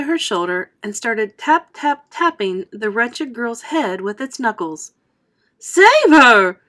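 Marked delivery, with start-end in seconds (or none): 5.6-6.6 loud, high panicked scream. A narrator's reading. Mother screams for her daughter, loud and panicked. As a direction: shriek for help. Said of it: "screamed Missus Salt".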